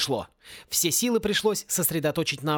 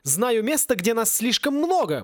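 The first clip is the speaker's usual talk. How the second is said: higher, eager exclamation; exclaiming; 6 semitones higher than usual. A narrator's reading. Wizard blurting out a hiding place, exclaiming. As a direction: call it out as a sudden idea. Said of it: "воскликнул Луж и исчез".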